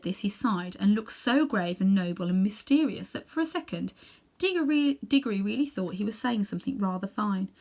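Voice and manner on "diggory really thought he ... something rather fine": usual speaking voice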